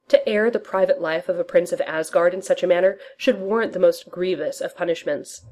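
ordinary delivery, nothing marked

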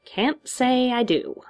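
slow, high, careless drawl; Joker. A narrator's reading. Villain answers hero's question, careless drawl. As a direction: shrug it off in a high drawn-out voice. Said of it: "The Joker shrugged".

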